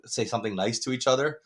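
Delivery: ordinary talk, nothing marked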